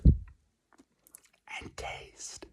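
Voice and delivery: hushed whisper; ASMR whisper. Pretend. Teasing an ASMR tasting.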